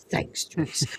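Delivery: hushed deadpan thanks; flat whispered thanks. Retold or imitated. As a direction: murmur it under your breath. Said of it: "in exactly the same way that he did before".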